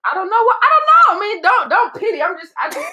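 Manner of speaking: high, loud, flustered protest; flustered outburst. Real, not acted. Opening up to podcast cohost about not feeling cared for, high loud flustered outburst. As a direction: blurt it out, embarrassed, half shouting. Flustered after friend's question.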